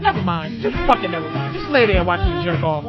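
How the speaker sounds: usual speaking voice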